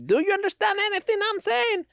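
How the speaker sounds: high put-on Jackie Chan voice; Jackie Chan impression. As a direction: imitate Jackie Chan's accented English. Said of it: "which one was that one".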